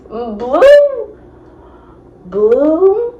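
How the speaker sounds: high, drawn-out whimpering name; hammed-up tearful plea; mock needy whine. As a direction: whine it up high like a weepy drunk. Crying self whines boyfriend's name.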